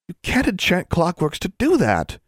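a friend caught between awe and alarm; appalled exclamation. A narrator's reading. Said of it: "she exclaimed".

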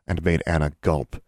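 plain reading voice

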